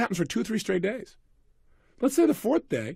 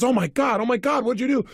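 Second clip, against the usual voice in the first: panicked; 5 semitones higher than usual. Retold or imitated. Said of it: "She immediately freaks out".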